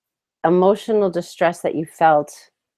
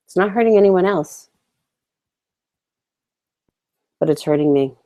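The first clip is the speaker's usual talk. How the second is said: guided tapping script. Acted. Coach leading viewers through a tapping round, calm and measured. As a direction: say each line so listeners can echo it back.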